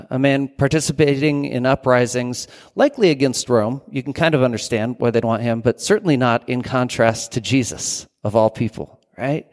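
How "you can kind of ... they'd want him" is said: ordinary talk, nothing marked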